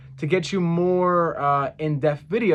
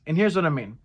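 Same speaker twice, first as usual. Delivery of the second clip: ordinary talk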